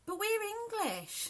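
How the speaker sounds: put-on high, slow child's indignant voice; son's protest. Son protesting to his mum, high childish voice. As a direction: play the little son objecting. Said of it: "And he goes".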